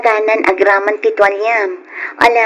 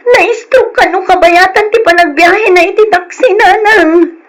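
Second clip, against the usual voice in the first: panicked report; 8 semitones higher than usual. Acted. Daughter telling mother about the stroke, high frantic voice. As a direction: blurt it out in alarm.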